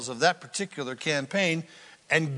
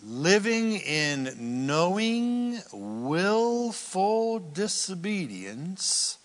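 slow emphasis; 4 semitones higher, much slower than usual. Acted. Preacher slows down for emphasis.

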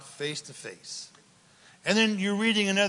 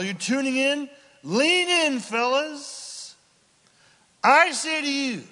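louder, higher, hammering each phrase; full-force preaching; 6 semitones higher, 6 dB louder than usual. Acted. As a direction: lean in and thunder the revelation.

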